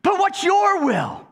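high, slow, insistent begging; acted high-pitched frustration; exasperated plea. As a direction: press God for an answer, pitch leaping up. Praying person pleads with God.

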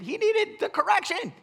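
normal talking voice

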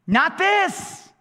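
high, emphatic plea; acted desperate protest; crying out. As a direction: cry it out to God, pitched high. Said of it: "He cries out".

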